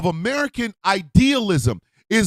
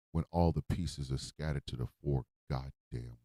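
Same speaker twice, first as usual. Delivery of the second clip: much softer and lower, worn out; bitter hush; 11 semitones lower, 11 dB quieter than usual. Real, not acted. Rejecting calls for unity, soft bitter weariness. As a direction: sink into a low, weary bitterness. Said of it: "I'm hurt".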